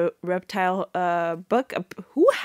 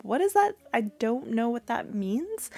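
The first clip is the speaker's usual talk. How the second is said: baffled; 4 semitones higher, 3 dB quieter than usual. Retold or imitated. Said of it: "and I'm like".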